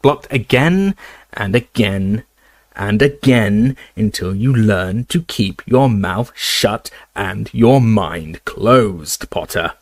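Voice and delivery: slow, drawn-out sneer. Acted. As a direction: let each word drip with contempt. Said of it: "sneered Snape".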